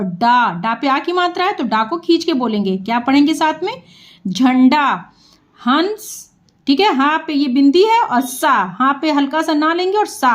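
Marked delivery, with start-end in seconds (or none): none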